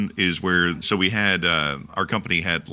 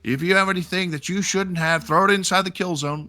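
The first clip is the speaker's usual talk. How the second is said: imitated drill sergeant orders; 11 semitones higher than usual. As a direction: play the drill sergeant calling out. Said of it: "the drill sergeant's like".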